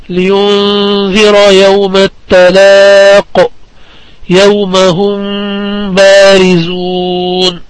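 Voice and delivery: very slow "لينذر يوم التلاق، يوم هم بارزون" with long held vowels and the م of هم hummed into بارزون; slow Quranic chant of "يوم هم بارزون", the م held before ب; tajweed recitation. Shown as a demonstration. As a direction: intone it evenly, lingering on the ghunna in لينذر and هم بارزون. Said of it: "ويكون إخفاء الميم الساكنة عند حرف".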